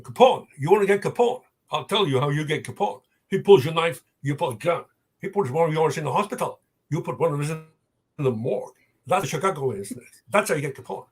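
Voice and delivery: a light Scottish Sean Connery accent, imitating him in The Untouchables; playful Sean Connery Scottish accent; Sean Connery impression. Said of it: "doing Sean Connery accents".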